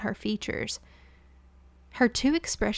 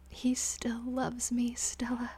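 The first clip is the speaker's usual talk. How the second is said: slow, softer whisper with a wobbly smile; cling to the hope in a whisper; hurt maid whispering to her friend; 2 semitones higher, 7 dB quieter, slower than usual. Acted. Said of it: "she whispered with a wobbly smile".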